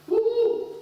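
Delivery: high, loud whoop; cheer. Real, not acted. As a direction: shout for joy. Preacher cheers about joy.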